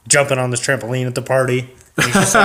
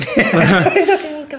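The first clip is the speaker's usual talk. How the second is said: much higher, very slow, laughing cover-up; mock innocent dad; slower than usual. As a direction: drag it out high while laughing.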